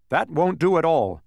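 high, loud mild scolding. A narrator's reading. Canby to the fallen humbug, scolding. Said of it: "scolded Canby".

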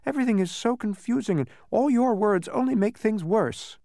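put-on high, exasperated boy; high-pitched frustrated protest. A narrator's reading. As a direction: complain in confusion. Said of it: "protested Milo".

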